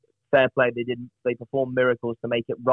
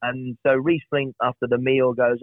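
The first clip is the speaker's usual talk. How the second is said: usual speaking voice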